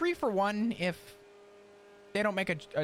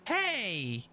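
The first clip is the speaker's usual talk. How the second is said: odd greeting voice; 2 semitones lower than usual. Real, not acted. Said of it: "Why are you saying it like that?".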